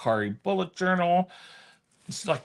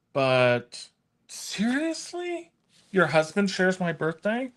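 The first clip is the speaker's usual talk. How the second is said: surprised; 6 semitones higher than usual. Real, not acted. Reacting to a chat comment.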